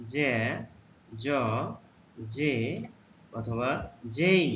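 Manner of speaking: জে, জ, জে, যেই said one by one, slow and clear; teacher modelling জে জ জে যেই; letter sounds demo. Shown as a demonstration. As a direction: model জে জ জে যেই with a pause after each. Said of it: "জেড এর উচ্চারণ".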